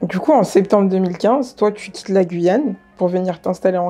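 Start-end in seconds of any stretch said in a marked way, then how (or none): none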